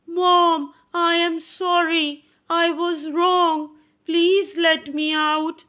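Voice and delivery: a hint of a sorry child begging; pleading boy. John through the door to his mother, lightly voiced pleading boy.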